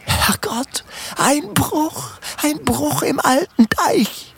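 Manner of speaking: high terrified cry of alarm over the breach; cry of mortal terror. A narrator's reading. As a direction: gasp it out in deadly fear. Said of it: "wie ihn nur die Todesangst".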